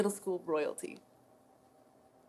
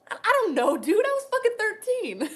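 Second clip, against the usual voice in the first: high, loud, incredulous protest; defensive outburst; 11 semitones higher, 5 dB louder than usual. Acted. Defending a thirteen-year-old's ignorance to a friend, exasperated. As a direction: protest it with pitch shooting up.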